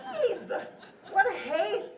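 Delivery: sudden high exclamations; high exasperated yelps; exasperated exclaim. Acted. Nurse exclaims at impatient Juliet.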